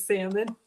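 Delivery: "salmon" with a silent L, SAM-un; salmon without the L. Retold or imitated. Host reading a viewer's vote, salmon without the L. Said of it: "if you pronounce salmon without the L".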